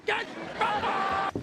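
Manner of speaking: drawn-out shout. Acted. Boss repeats farewell, drawn out.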